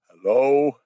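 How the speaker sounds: loud, questioning call into a shelter. Real, not acted. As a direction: call out to see if anyone's in. Calls into a possibly empty shelter.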